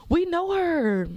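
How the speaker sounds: high-pitched, drawn-out mock boast; roommates showing off. Roommates bragging about their TikTok famous friend, high drawn-out crowing. Acting out impressed future roommates.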